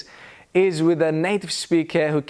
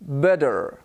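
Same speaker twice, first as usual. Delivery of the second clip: American "better" with tapped t and sounded r; imitated American r on "better"; American pronunciation; 3 semitones lower than usual. Shown as a demonstration. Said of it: "an American would say".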